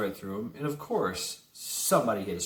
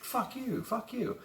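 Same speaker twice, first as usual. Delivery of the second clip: high mocking squeal of an old man's curse; mock old man cussing; 13 semitones higher, 5 dB quieter, slower than usual. Old driver cursing at armed crowd, high mock squeal. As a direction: spit it out in a silly high voice.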